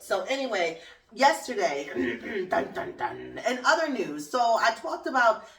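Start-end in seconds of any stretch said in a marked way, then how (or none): 2.5-3.4 mock dramatic sting.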